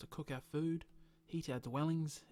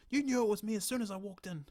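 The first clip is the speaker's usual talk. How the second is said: high, loud accusing; angry accusation; 7 semitones higher, 9 dB louder than usual. Acted. Stranger accusing the bartender of knowing him.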